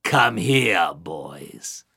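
very low, dark, fading growl; menacing goddess. Acted. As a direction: lure them in, deep and sinister. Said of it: "she looking at them saying".

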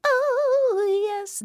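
very high, drawn-out "oh"; tiny high bird voice gliding down; delighted bluebirds. A narrator's reading. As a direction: coo it high and long. Bluebirds' cry entering garden.

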